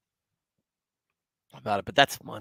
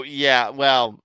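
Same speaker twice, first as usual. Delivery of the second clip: ordinary talk, nothing marked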